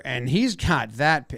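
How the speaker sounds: ordinary delivery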